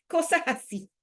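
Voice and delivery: said through a short laugh. Real, not acted. Mother laughing about her son's pranks, amused. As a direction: laugh it off lightly.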